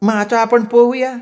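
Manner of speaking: high woman's invitation; coaxing sweetheart. A narrator's reading. Woman inviting him for a swim, high coaxing. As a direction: coax in a high put-on pitch.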